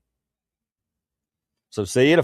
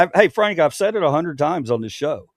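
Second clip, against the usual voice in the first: ordinary talk, nothing marked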